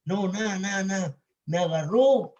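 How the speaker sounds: normal talking voice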